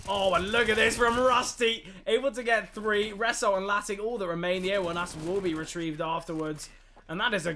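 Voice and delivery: loud burst of live commentary, easing slightly; excited play-by-play. Real, not acted. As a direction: hype the triple kill at high volume. Caster calls a live triple kill.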